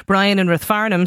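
usual speaking voice

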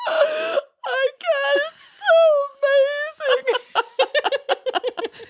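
very high, tearful, breaking voice; hammed-up happy crying; mock overwhelmed crying. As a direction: sob it out, overcome with joy. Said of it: "I'm on the balcony".

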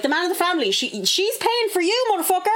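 high, exasperated rant; telling off the boyfriend, a mock outraged rant; mock outraged retort. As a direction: tear into him, half joking. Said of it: "I'm like".

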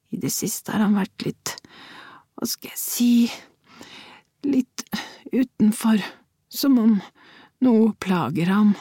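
put-on worried old mother, halting; hesitant old woman. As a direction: grope for the right word. Old woman searches for words.

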